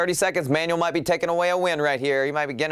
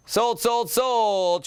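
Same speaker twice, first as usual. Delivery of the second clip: auctioneer; 6 semitones higher, much slower than usual. Acted. Live auction closes the bid.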